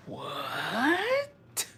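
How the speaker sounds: breathless, quiet stunned what; floored by the news. Real, not acted. Reacting to shocking detail.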